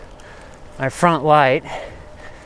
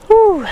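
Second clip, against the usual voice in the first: loud relieved whew; 7 dB louder than usual. Real, not acted. Relieved exhale while riding.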